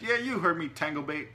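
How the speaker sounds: high, loud, petulant rebuke; peevish snap. A narrator's reading. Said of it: "he snapped peevishly".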